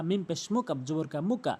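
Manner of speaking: normal talking voice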